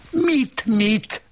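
very high, exasperated outburst; high-pitched indignant snap; snapping. A narrator's reading. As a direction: snap the question back sharply, voice shooting up. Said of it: "Csattant fel az asszony".

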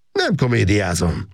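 cold. A narrator's reading. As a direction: shut the accusation down coldly. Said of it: "mondta ridegen Makos Gábor".

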